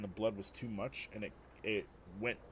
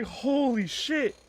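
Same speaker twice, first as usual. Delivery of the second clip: stunned; 11 dB louder, much slower than usual. Retold or imitated. Stunned after waking choking.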